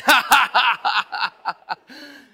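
very loud staccato mock laugh; high, booming "ha-ha-ha" of victory; villainous gloat, played to the crowd; triumphant gloating laugh. Pulls out his own saved egg.

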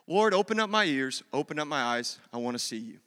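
low, hushed plea to God; prayer. Shown as a demonstration. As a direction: sink softly into an intimate prayer. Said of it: "just pray this prayer".

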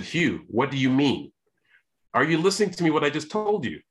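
can't believe he's not listening; exasperated retelling of a protest; incredulous protest. Retold or imitated.

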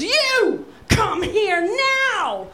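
slow, high bellowed order; imitated angry warden hollering a command; pissed-off shout. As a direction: yell each word drawn out, furious warden. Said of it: "and he's pissed".

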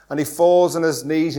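usual speaking voice

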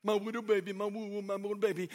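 low, soft singsong mockery; mocking taunt. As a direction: tease in babbling baby talk. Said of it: "He's mocking your baby".

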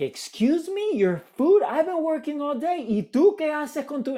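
high, loud mock outrage from the mother; hammed-up wife snapping back at husband; indignant mother. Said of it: "And she would be like".

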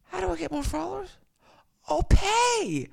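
very high, fast, pleading; mock desperate squeak; falsetto plea. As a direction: beg in a squeaky voice.

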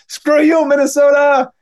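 charging war cry at the Minnesota guy; defiant yell. Retold or imitated. Kid charges at the wall.

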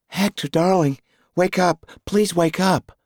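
high, loud urgent woman calling; sweet feminine voice. A narrator's reading. Wife shaking Hector awake, high urgent calling. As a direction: call him out of his nightmare. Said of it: "the sweet feminine voice".

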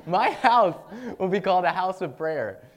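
high, loud and booming declaration; preacher booming Jesus's rebuke at the traders; proclaiming. Acted. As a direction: thunder it out like a prophet. Reading Jesus rebuking temple traders.

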